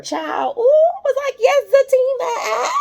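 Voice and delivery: very high, loud cheering squeal; excited squeal. Real, not acted. Viewer cheering Fatima as Zach walks in, squealing.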